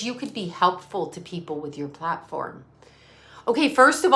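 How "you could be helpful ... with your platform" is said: softer, lower mock viewer voice; prim quiet mock viewer. Quoting a viewer, soft and lower. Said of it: "She goes".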